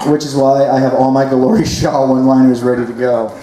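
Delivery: normal talking voice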